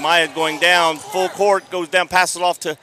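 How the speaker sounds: loud, driving live game call; excited play-by-play. Real, not acted. Commentator following Maya down court, excited play-by-play. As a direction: call the fast break loudly, riding the action.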